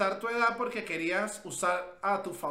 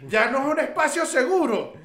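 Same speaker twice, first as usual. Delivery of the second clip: high, loud laughing protest; mock outrage; 7 semitones higher, 5 dB louder than usual. Protesting the podcast is no safe space, mock outrage. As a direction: complain loudly, half laughing.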